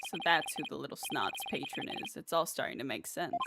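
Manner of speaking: low, soft, self-satisfied voice; scheming villain's aside. Acted. Schemer privately working out who the patron is, low and soft. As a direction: think aloud softly, low and sly.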